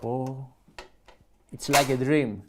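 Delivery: normal talking voice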